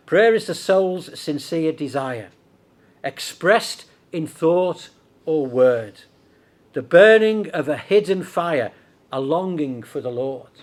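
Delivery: slow, measured reading of verse; solemnly paced poem from the pulpit; hymn recitation. Acted. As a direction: recite the hymn deliberately, phrase by phrase. Reads an old hymn aloud.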